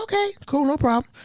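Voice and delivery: bright high okay, eager to please; chirpy yes-man reply; eager agreement. Retold or imitated.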